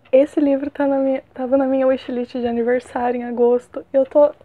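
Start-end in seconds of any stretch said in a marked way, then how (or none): none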